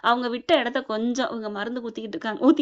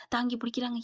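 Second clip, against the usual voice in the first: ordinary delivery, nothing marked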